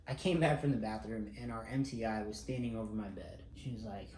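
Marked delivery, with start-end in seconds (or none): none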